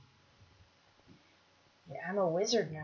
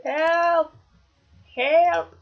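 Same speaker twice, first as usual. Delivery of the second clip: high, loud, drawn-out call; cry for help; 8 semitones higher, 9 dB louder than usual. Real, not acted. Player under attack calling teammates, loud cry for help. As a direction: call out for rescue.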